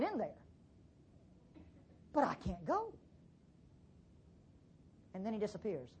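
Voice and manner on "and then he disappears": normal talking voice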